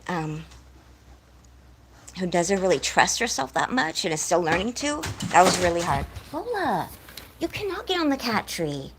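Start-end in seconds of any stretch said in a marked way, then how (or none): 6.3-8.9 high, exasperated scold at a pet; a real telling-off of her cat. Real, not acted. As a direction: tell the cat off, fed up.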